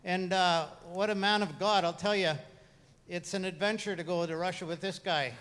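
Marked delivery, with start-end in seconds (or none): none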